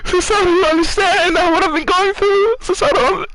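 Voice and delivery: high, desperate pleading; frantic pleading. Pretend. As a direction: beg her, coming apart. Mock mental breakdown plea.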